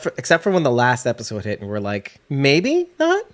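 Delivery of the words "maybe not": sudden high doubtful "maybe not"; surprised question. Retold or imitated. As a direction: ask it in sudden high disbelief. Doubting reaction quoted, high.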